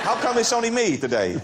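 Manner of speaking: mock lonely lockpicker. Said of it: "Crazy guy we're gonna have".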